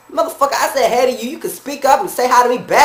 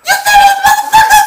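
very high, loud comic screech; hammed-up high-pitched yell; worked-up yelling; 6 dB louder than usual. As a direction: squeal in outrage to the boss. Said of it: "you ain't supposed to be yelling".

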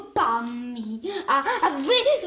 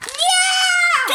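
high, long-drawn shouted no; shouted refusal; 10 semitones higher, 3 dB louder than usual. Pretend. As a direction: cry out a stretched refusal. Refusing in pretend drill.

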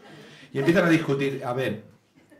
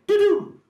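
high, loud electronic beep; imitated car alarm chirp; car lock beep; 8 dB louder than usual.

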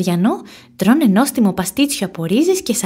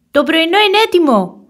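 calling out; 8 semitones higher, 5 dB louder than usual. A narrator's reading. Badger boy calling his friend to breakfast, loud. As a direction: call out across the house, louder. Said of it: "φωνάζει".

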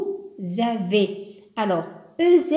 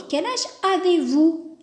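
slow, higher polite question; 6 semitones higher, 3 dB louder, slower than usual. Shown as a demonstration. Teacher repeats the polite question to an older person. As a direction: ask it slowly and respectfully. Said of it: "ensuite vous avez".

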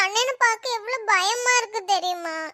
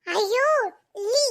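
ordinary talk, nothing marked

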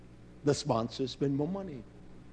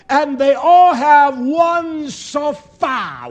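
loud, slow scripture reading with a lifted voice; fiery sermon reading; preaching scripture; 6 semitones higher, 10 dB louder, slower than usual. Acted. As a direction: proclaim the verse to the congregation. Preaching scripture at full force.

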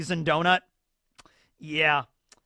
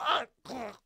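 dying groan; 7 semitones higher, 6 dB quieter than usual. Retold or imitated. Customer dying.

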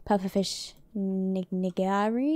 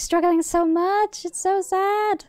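pitying coo; 9 semitones higher, 4 dB louder, slower than usual. Real, not acted. Watching a character cry.

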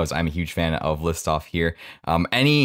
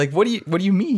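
high, incredulous imitated reaction; mock disbelief; 13 semitones higher than usual. Host imagining his reaction to the name-drop, incredulous. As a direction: act baffled, pitch jumping up.

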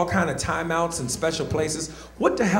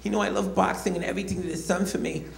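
Mike Tyson impression; 3 semitones higher, 4 dB quieter than usual. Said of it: "I did the impression".

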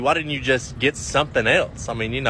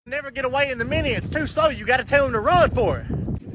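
much higher frantic voice from hiding; high hiding worker; 10 semitones higher than usual. Acted. Hidden worker pleading with boss in a much higher voice. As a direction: squeak it out like a panicked hidden worker.

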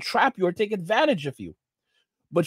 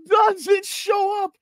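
gleeful shout; 13 semitones higher, much slower than usual. Real, not acted. Telling viewers the cop never came to court, gleeful slow shout. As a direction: crow the punchline.

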